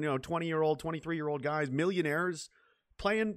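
usual speaking voice

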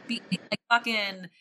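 ordinary talk, nothing marked

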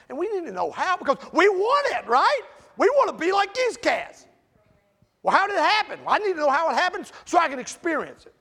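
high and loud, pressing the point; preaching shout. Real, not acted. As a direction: drive it home at full force. Preaching climax, full force.

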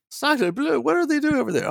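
put-on high, hammy French accent; shocked Parisian in a French accent; french chef. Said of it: "I think we should get you to dub this episode".

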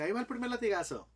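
más agudo y rápido, anuncio burlón del diablo; anúncialo como un diablo divertido; diablo anunciando. Acted. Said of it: "el diablo dice". (el diablo anuncia el primer latigazo, agudo y rápido)

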